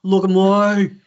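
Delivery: broad Kath and Kim "Look at me"; Kimi impression. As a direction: do Kimi showing off.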